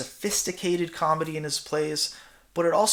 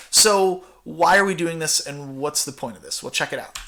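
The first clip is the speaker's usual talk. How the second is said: usual speaking voice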